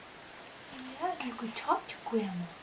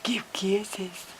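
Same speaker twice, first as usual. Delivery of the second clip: ordinary talk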